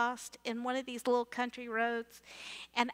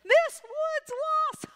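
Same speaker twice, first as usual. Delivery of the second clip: high, loud, slow jeering; teasing sing-song from kids in the back seat; mocking teens; 6 dB louder, slower than usual. Kids in the back seat taunt their lost teacher, high and loud. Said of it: "are laughing hysterically".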